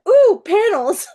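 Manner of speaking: high, loud, drawn-out "ooh"; easily distracted delight. Author hears about a panel, instantly excited. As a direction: perk up like a dog spotting a squirrel. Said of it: "Like a dog squirrel".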